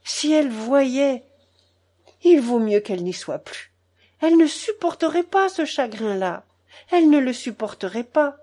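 high, anguished wail in words; tearful lament. A narrator's reading. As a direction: lament with growing distress. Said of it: "grand-mère pleurait de plus en plus fort".

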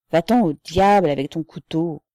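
loud, hearty cry; shouted. A narrator's reading. The little Russian dismissing the offer of a knife, shouting. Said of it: "cria le petit Russien".

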